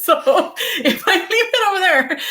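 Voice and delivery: high-pitched, laughing through the words; real laughter bubbling through the sentence. Real, not acted. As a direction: crack up while talking. Laughs while admitting her forgetfulness.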